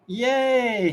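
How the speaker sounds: high, loud happy cheer. Real, not acted. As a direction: celebrate a winning roll. Player beats the roll.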